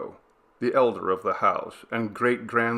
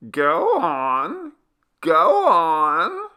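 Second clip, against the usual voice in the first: put-on high old woman voice; slow granny; 9 semitones higher, 8 dB louder, much slower than usual. A narrator's reading. Grandmother slowly telling the men to go on. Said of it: "said the old woman slowly".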